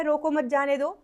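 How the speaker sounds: रोको मत जाने दो in one unbroken run, ending up; no pause. Shown as a demonstration. Teacher shows the unclear meaning, running words together without pause. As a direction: read "रोको मत जाने दो" straight through without any break. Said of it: "इसका कोई अर्थ नहीं समझ में आ रहा".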